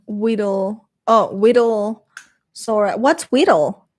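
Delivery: normal talking voice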